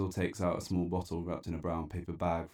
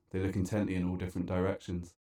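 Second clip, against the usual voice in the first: usual speaking voice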